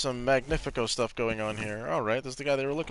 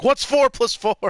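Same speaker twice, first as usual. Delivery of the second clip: higher and louder mock teacher quiz; 11 semitones higher, 9 dB louder than usual. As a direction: bark the sum at a small child like a strict teacher. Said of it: "they're like".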